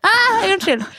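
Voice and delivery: high mock call after a passer-by. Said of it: "det var liksom sånn, akkurat sånn". (gjentar damens rop, høyt og lyst)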